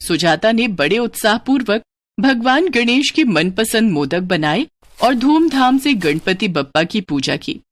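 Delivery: ordinary delivery, nothing marked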